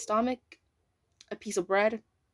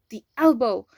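pained cry; 2 semitones higher, 9 dB louder than usual. A narrator's reading. Sudden pain in narration.

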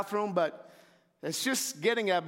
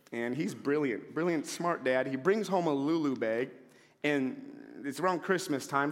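usual speaking voice

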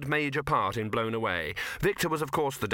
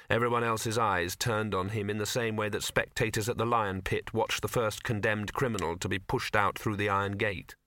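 ordinary delivery

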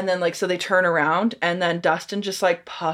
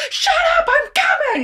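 high, loud imitated kid's shriek; screaming; 5 dB louder than usual. Mike yelling at his mom, imitated high scream. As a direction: scream back like a fed-up boy.

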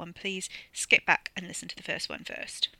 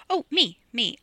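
eager volunteering; 5 semitones higher, 7 dB louder than usual. Acted.

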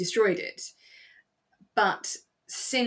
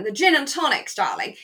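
high, loud, lah-di-dah posh voice; plummy upper-class voice; posh drawl; 7 semitones higher, 7 dB louder than usual. Retold or imitated. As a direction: play the posh lady ordering her gin and tonics. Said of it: "She's, she's very posh".

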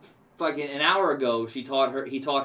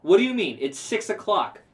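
higher, louder disbelief; mock outrage; 8 semitones higher, 5 dB louder than usual. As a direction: act stunned at the closing time. Outraged at closing time.

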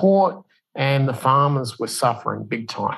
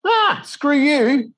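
much higher and drawn out slowly, a sneering brush-off; mock dismissive retort; 13 semitones higher, slower than usual. Mocking China brushing off the Prime Minister, high sneering drawl. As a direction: toss it off slow and high like a scornful foreign official.

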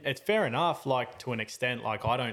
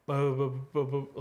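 mock spluttering babble; imitated spluttering; 2 semitones higher, faster than usual. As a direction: imitate a dazed man sputtering through his lips. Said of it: "like, just spit".

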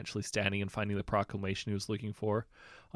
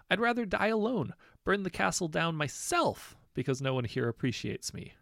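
melodramatic sulk; 9 semitones higher, 3 dB louder than usual. Acted. Prince sulks melodramatically.

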